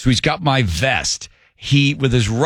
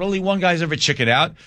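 ordinary talk

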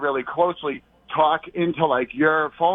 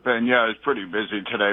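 usual speaking voice

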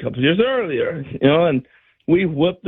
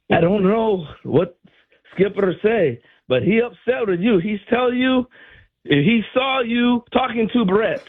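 imitating the coach; 6 semitones higher than usual. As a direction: warn him gently like a baseball dad. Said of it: "he goes".

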